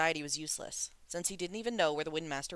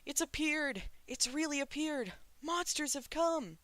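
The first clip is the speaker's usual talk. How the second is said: high frightened shrieks, slowing on the last; panicked crowd cries; 11 semitones higher than usual. A narrator's reading. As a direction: cry out in terror as the crowd. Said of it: "started screaming wildly".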